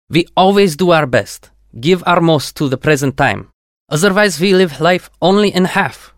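put-on proud Russian-accented engineer. A narrator's reading. Yuri speaks with Russian accent.

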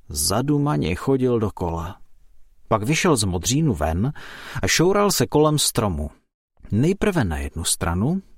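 ordinary delivery, nothing marked

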